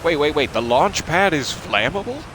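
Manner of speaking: startled by the flammable launch pad, alarmed; alarmed surprise. Real, not acted. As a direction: stop short in alarm at the news. Learns the launch pad burns.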